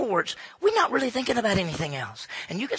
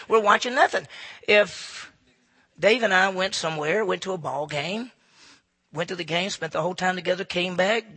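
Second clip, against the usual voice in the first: ordinary talk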